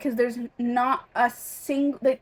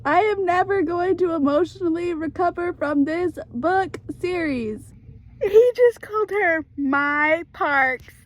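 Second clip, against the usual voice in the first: overwhelmed fan; 6 semitones higher than usual. Real, not acted. Gushing over a book couple.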